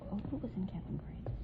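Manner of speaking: ordinary talk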